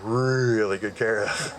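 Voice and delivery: drawn-out "really", tipping into a laugh; slow, chuckling stress on really; amused emphasis. Real, not acted. Patient boasting to audience about hospital perks, amused emphasis.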